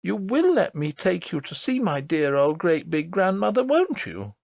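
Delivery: put-on high, earnest child's voice; a small girl insisting, wide-eyed; little princess voice. A narrator's reading. Princess tells her nurse about the lady, earnest.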